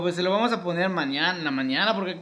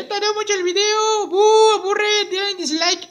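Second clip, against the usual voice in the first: high, loud-ish puppet jeering; heckling puppet; 13 semitones higher, 5 dB louder than usual. Pretend. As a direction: boo the video in a squeaky voice. Muñeco abuchea el video. (abucheo con voz aguda de muñeco)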